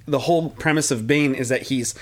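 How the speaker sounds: usual speaking voice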